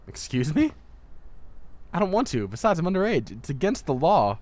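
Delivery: high, loud indignant refusal; indignant protest. Acted. Student refusing her mentor's order, indignant and loud.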